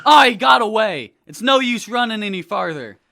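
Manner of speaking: frustrated shout. Acted. Officers calling as suspect escapes.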